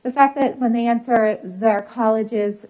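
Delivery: ordinary talk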